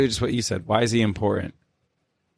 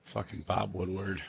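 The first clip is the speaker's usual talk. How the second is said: muttered; 13 dB quieter than usual. Real, not acted. Muttered aside.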